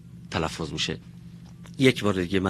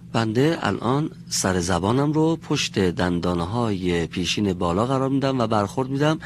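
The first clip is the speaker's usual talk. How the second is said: ordinary talk, nothing marked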